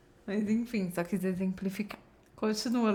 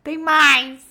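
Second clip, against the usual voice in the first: loud interjection; 6 semitones higher, 12 dB louder than usual. Real, not acted. Friend shouts interjection.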